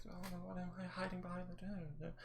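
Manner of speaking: high, soft put-on voice; muttering offstage character. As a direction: fret to yourself in a small high voice. Game master voices someone muttering offstage.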